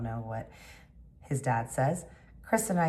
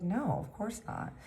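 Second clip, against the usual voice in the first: high reassurance; 8 semitones higher, 4 dB quieter, slower than usual. Retold or imitated. Reassuring Harley in retold chat.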